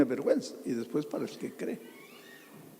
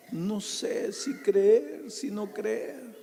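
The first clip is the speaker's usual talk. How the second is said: mocking drawl; 8 semitones higher, much slower than usual. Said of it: "Y a Jesús".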